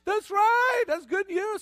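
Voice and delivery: loud, high cheer; excited good news. Acted. Preacher affirms the good news to the congregation, loud and excited. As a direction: celebrate it at full volume.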